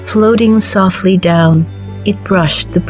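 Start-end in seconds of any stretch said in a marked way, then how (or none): none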